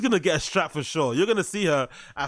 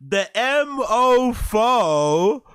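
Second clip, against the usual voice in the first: very slow, high, louder mock; mocking mummy voice; 4 semitones higher, 4 dB louder, much slower than usual. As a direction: drag it out in a prim mum voice. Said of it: "I love her mummy voice".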